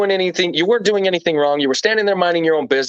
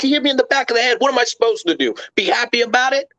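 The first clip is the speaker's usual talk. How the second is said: higher, defensive comeback; indignant retort; 8 semitones higher, faster than usual. Retold or imitated. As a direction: snap back like the answer is obvious. Defending himself to his friends.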